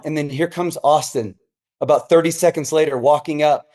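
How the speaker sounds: ordinary delivery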